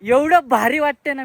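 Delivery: loud, high, thrilled at the top; bursting with joy after the climb; excited shout. Real, not acted. Vlogger on the fort summit, loudly thrilled. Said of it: "मी जास्त ओरडतोय".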